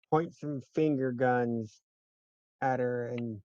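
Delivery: ordinary talk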